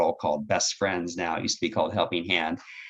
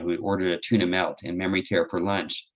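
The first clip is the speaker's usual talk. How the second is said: ordinary talk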